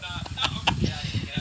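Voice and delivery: high, soft laugh; quiet, breathy real laughter. Real, not acted. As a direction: laugh quietly at your own joke. Laughing at own joke.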